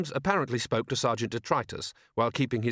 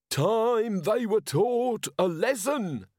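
high, slow soapbox ranting; screamed harangue; 11 semitones higher, 3 dB louder, much slower than usual. A narrator's reading. Street speaker haranguing a crowd, screaming. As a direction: draw out each word in a high-pitched rabble-rousing cry. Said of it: "screamed the nearest one".

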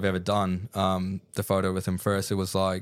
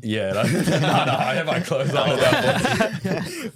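laughing through it, slow and higher; laughing denial; 5 semitones higher, 5 dB louder, slower than usual. Real, not acted. Guest denies wearing the mankini while laughing, higher and slower. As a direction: laugh while waving off the idea, slowly.

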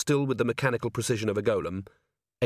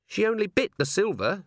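high, absent-minded remark; distracted; 9 semitones higher than usual. A narrator's reading. Dwarf explaining the bite to Vimes, distracted. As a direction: say it as if thinking aloud.

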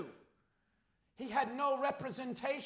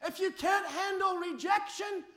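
loud, rising preacher's cry to the flock; fiery preaching; 6 semitones higher, 5 dB louder than usual. Acted. Pastor pleading with the congregation, loud fiery preaching. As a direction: preach it out, pushing hard on every phrase.

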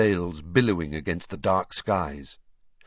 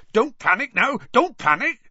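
much higher, louder panicked yell; 5 dB louder than usual. Acted. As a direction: bellow at the fleeing class. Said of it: "Hagrid was soon yelling".

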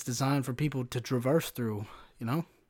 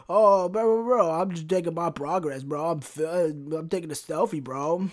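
mock whining bro; 2 semitones higher than usual. Flexing guy excusing his selfie, mock protesting. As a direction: imitate a defensive gym bro, hammed up.

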